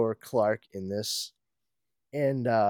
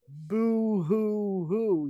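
high, very slow mock sobbing; boo-hoo it like a crybaby; fake crying; 8 semitones higher, much slower than usual. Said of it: "Lex freaks out".

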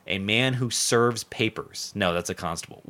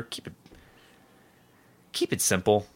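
normal talking voice

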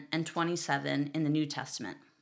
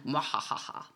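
evil cackle; 3 semitones lower, 4 dB quieter than usual. Pretend. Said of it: "wild-eyed lunatic".

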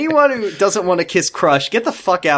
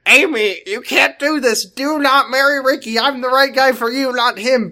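scolding; 9 semitones higher than usual. Acted. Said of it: "Ben scolded through the phone".

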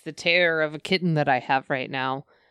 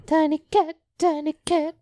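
high singsong; 10 semitones higher than usual. Pretend. Singsong chant about tiny cat.

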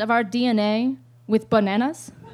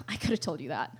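low, much softer bored aside; deadpan mutter; 9 semitones lower, 14 dB quieter than usual. Retold or imitated. Caitlin quietly dismissing the star chart, deadpan. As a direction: toss it off under your breath, unimpressed. Bored girlfriend voiced after sigh.

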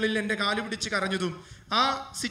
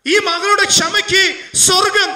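loud, high, slow pulpit shout; impassioned preaching; 10 semitones higher, 13 dB louder, slower than usual. Acted. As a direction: preach the appeal with full force. Preaching the closing appeal.

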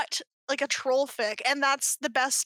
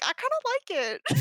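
sheepish admission; 6 semitones higher than usual. Retold or imitated. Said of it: "and I'm like".

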